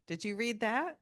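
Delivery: normal talking voice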